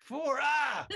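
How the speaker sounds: high, loud burst on the count; mock shout. Host counting a guest's silly list, mock shout. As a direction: belt out the number and tip into a yell.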